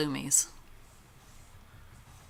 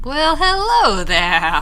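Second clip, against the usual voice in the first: excited; 7 semitones higher, 9 dB louder, much slower than usual. Real, not acted. Loud greeting in game.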